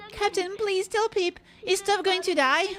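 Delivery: put-on a small boy voice, high and quick; high child voice. Ship's kid begging about the captain, high child voice.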